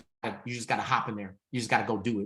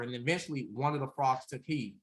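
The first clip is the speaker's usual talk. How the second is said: ordinary delivery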